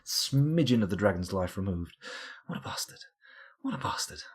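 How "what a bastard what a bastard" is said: very soft, voiceless whisper; whispered curse. Real, not acted. Player cursing the dragon that punished him, whispered. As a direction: curse it under your breath in a whisper.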